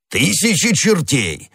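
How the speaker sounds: high, drawn-out exclamation; exclaiming. A narrator's reading. Musketeer walks in on his friends, exclaiming. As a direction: burst in with a bouncing, stretched oath. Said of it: "воскликнул он".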